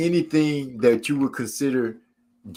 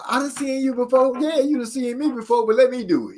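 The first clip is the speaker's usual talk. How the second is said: high-pitched, insistent protest; mock bickering; 10 semitones higher than usual. Couple arguing over bathroom privacy, mock exasperated. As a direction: argue back in a raised pitch.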